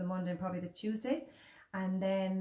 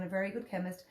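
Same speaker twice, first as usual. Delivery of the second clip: ordinary talk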